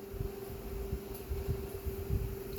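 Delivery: long breathy sigh fighting tears; shaky tearful sigh. Real, not acted. Grieving vlogger sighing through tears. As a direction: let out a trembling sigh. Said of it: "so much for not crying".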